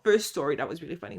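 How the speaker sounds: ordinary delivery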